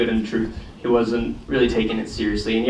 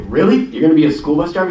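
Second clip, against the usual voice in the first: higher, incredulous question; mock disbelief; 4 semitones higher, 4 dB louder, faster than usual. Schoolmate reacting to the bus driver plan, retold disbelief. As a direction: question it quickly, pitched up in surprise.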